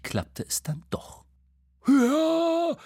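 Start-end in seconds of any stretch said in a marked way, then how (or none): 1.9-2.8 high, loud shout; cheer. A narrator's reading. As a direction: cheer as he spurs on. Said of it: "rief Bo". (lauter Jubelruf)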